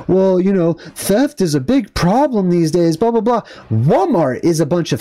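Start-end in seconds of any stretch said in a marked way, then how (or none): 0.1-3.4 lightly voiced dismissive imitation; mocking article writer. Vlogger imitating the article's author, mocking. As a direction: mimic a writer reciting tired arguments. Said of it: "That's this guy that wrote this article".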